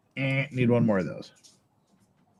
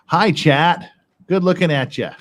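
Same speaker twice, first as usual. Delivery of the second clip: higher, louder hello to chat; cheery greeting; 6 semitones higher, 7 dB louder than usual. Real, not acted. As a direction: greet the chat with a bright, loud lift. Greeting stream chat loudly.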